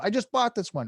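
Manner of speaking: mock a touchy, defensive comeback; triggered retort. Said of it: "And he gets triggered".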